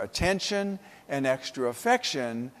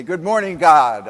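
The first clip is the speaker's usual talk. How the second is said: bright good morning; 9 dB louder than usual. Retold or imitated. Cheerful riser greets the day.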